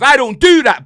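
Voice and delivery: loud, high, hammer the word do; indignant shout. Real, not acted. Fan shouting at his mate that he won't praise other clubs, indignant. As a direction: bellow it in protest.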